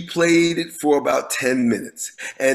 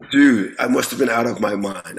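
ordinary talk, nothing marked

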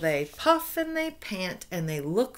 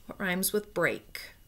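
ordinary delivery

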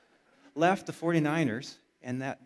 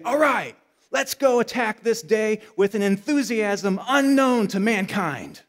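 loud, higher, slow rally to the day; coach's pep call; 5 semitones higher, 9 dB louder than usual. Retold or imitated. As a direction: pump the family up like a coach. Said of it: "And then his dad would say".

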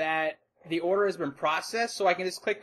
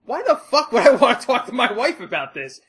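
much higher, louder, laughing disbelief; outraged yell; 8 semitones higher, 8 dB louder than usual. Retold or imitated. Retelling his reaction to the rep, high laughing outrage. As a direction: explode in mock outrage. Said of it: "I was like".

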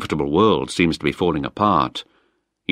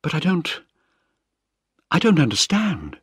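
higher, lightly voiced Penny, lost and confused; a stunned daughter, pitched up; young woman, bewildered; 11 semitones higher than usual. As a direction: daughter unable to grasp the letter, higher and bewildered. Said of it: "she cried".

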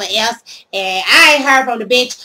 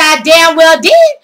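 louder, slow defiant boast; 5 semitones higher, 8 dB louder, slower than usual. Retold or imitated. Telling the officer she took the shoes, slow defiant boast. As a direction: own it proudly and loudly.

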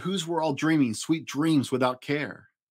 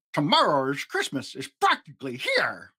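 Grinch snarling. Acted. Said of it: "he snarled with a sneer".

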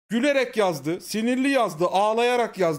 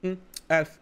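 unimpressed elf; 4 semitones lower than usual. Shown as a demonstration. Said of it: "Kulağı görmeden şöyle demen lazım".

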